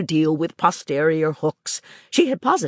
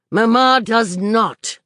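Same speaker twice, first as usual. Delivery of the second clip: slow, firm denial with no give; a governess contradicting the child outright; flat contradiction; 6 semitones higher, 5 dB louder, slower than usual. Acted. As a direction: shut her down flatly. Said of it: "Her friend contradicted her flat".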